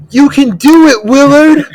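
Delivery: high, loud coach's shout of encouragement; hollered imitation of a teacher cheering someone on; yelling from afar. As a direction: bellow it like a football coach across a field. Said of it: "yelling from his classroom".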